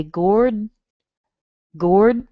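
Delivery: "gourd" ending crisp on the D; gourd with final D. Shown as a demonstration. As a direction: land the D at the end of "gourd". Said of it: "I will add the D on it and say".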